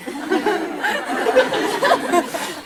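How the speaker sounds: long high laugh. Real, not acted. Laughing at the dog's return.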